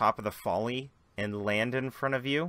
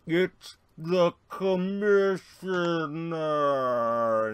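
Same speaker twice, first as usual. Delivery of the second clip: drawn-out slow-motion announcement; 6 semitones higher, 5 dB louder, slower than usual. Acted. Bystanders spotting the commissioner, in slow motion. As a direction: stretch the words like a slow-motion movie shot. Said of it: "in slow motion".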